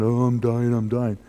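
low, self-pitying mock groan; mock moaning. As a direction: moan it like someone playing the martyr. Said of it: "It's not to walk around".

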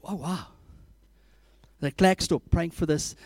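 ordinary talk, nothing marked

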